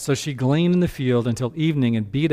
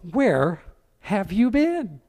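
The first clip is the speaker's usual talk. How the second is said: rising higher in shock; astonished question; 7 semitones higher, slower than usual. Retold or imitated. Naomi asks Ruth where she went, shocked and rising. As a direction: ask it like you can't believe it. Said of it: "her mother-in-law said to her".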